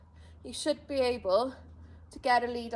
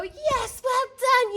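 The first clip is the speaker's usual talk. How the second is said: happy praise; 11 semitones higher, 7 dB louder, much slower than usual. Shown as a demonstration. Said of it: "you still recall them and go".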